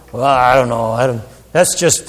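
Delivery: mock dismissive skeptic, hesitating; dismissive listener. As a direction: imitate someone shrugging off the preacher. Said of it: "then people walk away and you go".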